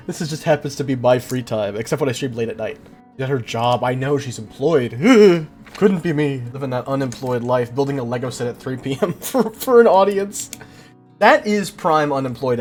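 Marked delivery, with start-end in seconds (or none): none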